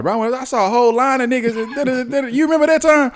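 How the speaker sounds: high-pitched mock storytelling voice; excited retelling. Telling his friend about the line he saw, hammed-up high excitement.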